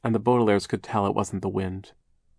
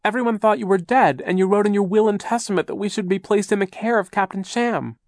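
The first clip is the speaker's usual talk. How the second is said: put-on upset girl; 12 semitones higher, 6 dB louder than usual. A narrator's reading. Violet upset continues.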